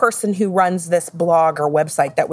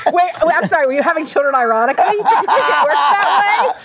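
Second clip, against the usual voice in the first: laughing disbelief; 7 semitones higher, 3 dB louder, faster than usual. Real, not acted. Teasing disbelief at the joke.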